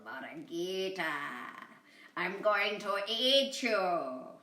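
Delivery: a hint of a slow growling coyote. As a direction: menace her slowly, lightly growled. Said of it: "The coyote growled".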